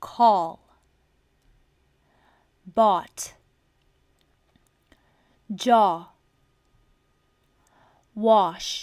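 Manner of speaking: call, bought, jaw, wash each with a loud open aw. Shown as a demonstration. Teacher calling out examples of the आ sound. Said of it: "फिर है".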